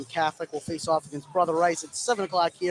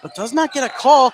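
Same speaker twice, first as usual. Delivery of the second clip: higher and louder, indignant call; excited call; 8 semitones higher, 9 dB louder than usual. Real, not acted. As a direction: sell the no-call. Contact with no foul called.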